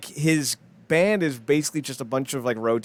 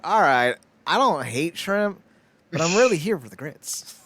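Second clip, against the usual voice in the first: mock diner; 5 semitones higher, 4 dB louder than usual. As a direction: sound mildly resigned but happy. Voicing a diner's inner thought.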